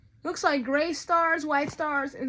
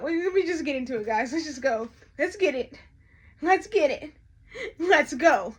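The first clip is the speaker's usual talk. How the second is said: usual speaking voice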